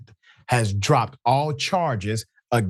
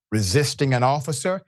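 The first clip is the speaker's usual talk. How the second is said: ordinary delivery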